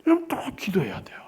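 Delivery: sudden whisper, breathy and hushed. Real, not acted. Pastor urging the congregation to pray, whispered. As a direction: drop to a secret whisper.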